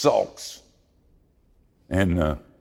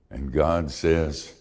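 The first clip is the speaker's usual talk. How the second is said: normal talking voice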